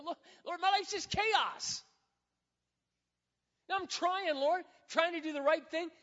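exasperated prayer. Retold or imitated. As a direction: vent to God, voice up and pushing.

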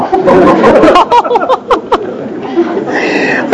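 high breathy burst of laughter at his own joke; big laugh. Real, not acted. Pastor laughing at his own checkbook joke, airy laugh.

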